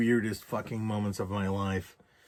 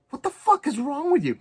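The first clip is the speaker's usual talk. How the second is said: incredulous, high-pitched retold outburst; rude outburst; 14 semitones higher, 4 dB louder than usual. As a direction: demand it rudely, voice jumping up high. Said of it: "a very rude and man thing".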